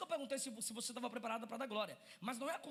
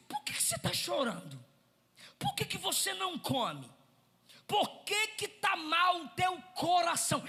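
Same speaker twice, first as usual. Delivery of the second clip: caring husband pleading; 5 semitones higher, 8 dB louder than usual. Acted. Husband begging his crying wife to tell him, a pleading shout. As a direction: press her with worried questions, higher. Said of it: "Ele olha e diz".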